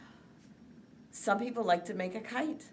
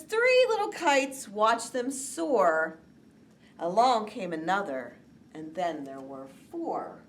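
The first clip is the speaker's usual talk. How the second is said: put-on slow, lilting rhyme for children; poem recital; 4 dB louder, slower than usual. Teacher reciting the kite poem to the class, lilting.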